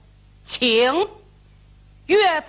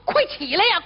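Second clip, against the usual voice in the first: urgent call; 10 semitones higher, 4 dB louder, faster than usual. Acted. Urging sleeping brother.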